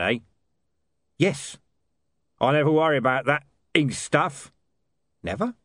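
lightly voiced gruff hitman; a hint of a blunt heavy; thug voice. Voices Mister Tulip.

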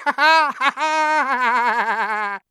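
loud mocking poltergeist laugh; high gleeful cackle. A narrator's reading. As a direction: cackle in triumph.

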